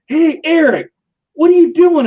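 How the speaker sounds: high, loud startled call; surprised buddy yelling. Retold or imitated. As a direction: catch your drunk friend in the wrong bed. Buddy finds him in wrong bed.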